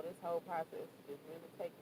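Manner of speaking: normal talking voice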